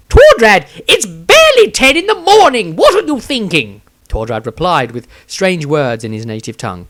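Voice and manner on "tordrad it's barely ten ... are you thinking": much higher, louder scandalised outburst; an appalled bard scolding his friend; shocked Maestro. A narrator's reading. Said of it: "eyes bugged out".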